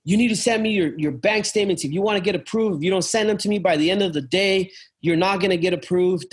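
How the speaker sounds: mock high-pressure phone pitch; aggressive salesperson. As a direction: press the demand like a pushy car salesman. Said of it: "He was super, super aggressive?".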